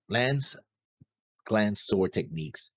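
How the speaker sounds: ordinary talk, nothing marked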